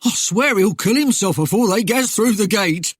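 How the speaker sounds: lightly voiced amused ruffian, high and loud-ish; mildly hooting at someone's clumsiness; guffawing highwayman. A narrator's reading. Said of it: "Then the man guffawed again".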